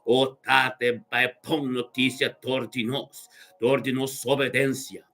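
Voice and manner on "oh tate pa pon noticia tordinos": ordinary talk, nothing marked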